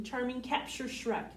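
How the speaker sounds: plain reading voice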